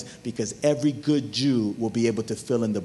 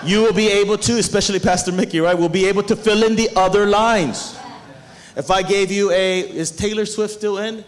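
raised, louder preacher's voice; high-energy pulpit delivery; preaching; 8 semitones higher, 7 dB louder than usual. Real, not acted. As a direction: drive the point home to the congregation. Preaches with rising force.

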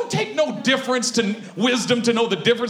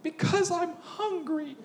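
lightly voiced child, higher and slow, with a laugh; little girl; 4 semitones higher, 5 dB quieter, slower than usual. Daughter explaining her crying, mock childish whine. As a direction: whimper it like a small girl. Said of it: "she'll say something ridiculous like this".

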